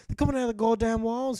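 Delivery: shouting in panic about the endless enemies; shouted. Acted. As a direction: yell it like a cornered soldier. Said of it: "he shouted".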